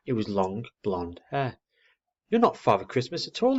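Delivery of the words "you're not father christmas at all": crossly. A narrator's reading. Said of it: "he said crossly".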